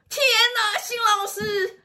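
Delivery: very high, crying, drawn-out exclamation; tearful cry of recognition. Retold or imitated. Girl recognizing her teacher on the street, crying out. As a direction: break into tears at the sight of her. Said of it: "然后就抱着我大哭".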